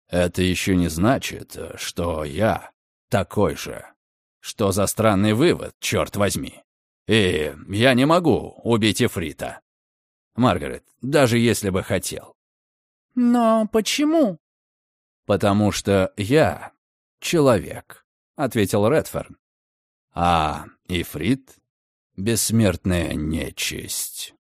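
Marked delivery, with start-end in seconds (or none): 0.1-18.0 low, slow, heavy male voice; brooding gentleman. Acted. Redfern denies being like Longsdale, low and slow. 20.1-24.3 low, very slow, soft male voice; ominous gentleman. Acted. Redfern names the immortal fiend, low ominous voice.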